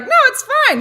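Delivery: very high, loud brush-off; imitating own squeaked-up objection; defensive protest. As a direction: protest too much, pitch shooting up. Acts out protest to insistent girlfriend.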